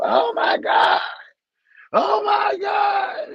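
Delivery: high shocked cry, drawn out on the second; real shock, voice leaping up. Real, not acted. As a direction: gasp it out in disbelief, way up high. Mock shock reaction.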